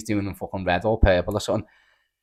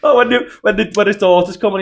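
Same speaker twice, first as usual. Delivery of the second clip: higher, louder, tearful laughing; crying with laughter; 9 semitones higher, 5 dB louder than usual. Real, not acted. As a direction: still wheezing from laughing.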